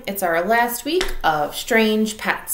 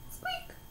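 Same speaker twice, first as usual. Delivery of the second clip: very high, much softer critter squeak; a faint little animal squeak; tiny squeak; 20 dB quieter than usual. Acted. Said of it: "He said".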